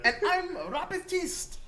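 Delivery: high, loud gnome voice; shrill-pitched comic self-introduction; gnome calling out. Acted. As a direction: announce yourself boldly as a little gnome. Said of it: "You hear a voice call out".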